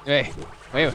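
ordinary talk, nothing marked

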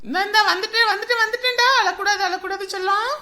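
put-on high, loud cooing to baby; urgent soothing call. Mother hurrying to her crying baby, urgent soothing. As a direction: call out that you're coming.

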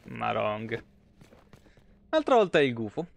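normal talking voice

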